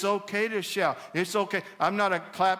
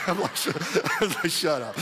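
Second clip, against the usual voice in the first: breaking up with laughter; laughing comeback. Retold or imitated. Pastor retelling his reply to convention head, laughing. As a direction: laugh through the words.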